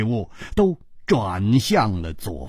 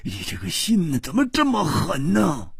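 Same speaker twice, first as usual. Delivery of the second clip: crying out; 7 semitones higher than usual. A narrator's reading. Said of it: "胡铁花又叫起来".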